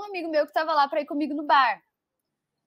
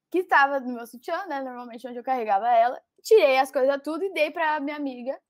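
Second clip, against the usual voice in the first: usual speaking voice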